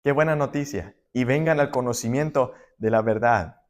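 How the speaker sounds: ordinary talk, nothing marked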